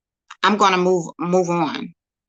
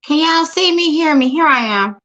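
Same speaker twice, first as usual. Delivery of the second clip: much higher, louder checking-in; calling out to viewers; 8 semitones higher, 4 dB louder than usual. Real, not acted. Streamer back online after freezing, calling out high and loud. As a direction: call out, relieved, to see if they're back.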